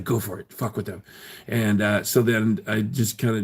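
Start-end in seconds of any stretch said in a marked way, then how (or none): 0.0-1.0 quiet. Retold or imitated. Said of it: "And she's like".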